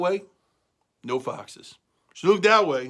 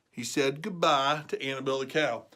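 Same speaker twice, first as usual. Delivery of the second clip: ordinary delivery, nothing marked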